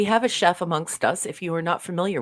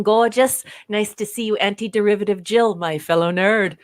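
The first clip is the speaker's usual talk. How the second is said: ordinary delivery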